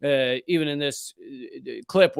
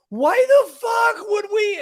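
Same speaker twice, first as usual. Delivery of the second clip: much higher, loud slow squeaky yell; squeaky outraged shout; 6 dB louder, slower than usual. Shown as a demonstration. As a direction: scream the question in disbelief. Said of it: "it should be squeaky".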